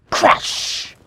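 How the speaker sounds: explosive sound word, long hiss; sudden loud crash. A narrator's reading. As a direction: make the crash burst out. Loud crash wakes the children.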